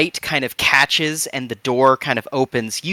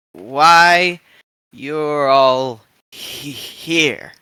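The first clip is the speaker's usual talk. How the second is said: put-on slow, halting old voice with stammers; slow stuttering old woman; 2 semitones higher than usual. Oma Mankfeld introducing herself to the crew, slow and stuttering. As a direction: stammer slowly like a frail but sharp old woman.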